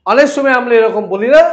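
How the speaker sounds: ordinary talk, nothing marked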